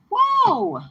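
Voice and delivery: high, loud gasp of wonder; sudden delighted whoa; surprised whoa. Real, not acted. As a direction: let the card stun you. Reacting to the Star card.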